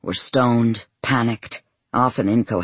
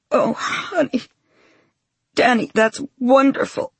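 higher, teary joy; overjoyed through tears; happy crying; 13 semitones higher than usual. Acted. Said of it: "It's happy crying".

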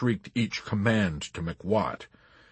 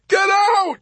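very high, loud hysterical shriek; hysterical yell; 8 dB louder than usual. A narrator's reading. Yossarian driving Arfi away, hysterical yell. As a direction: yell it in a frenzy. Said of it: "Yossarian yelled hysterically".

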